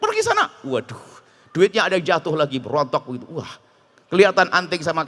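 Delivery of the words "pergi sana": much higher, hammed-up "get out"; mock angry dismissal. Debtor slams the door on the lender, hammed-up anger. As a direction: shoo the lender away in mock fury. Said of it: "dia malah marah-marah".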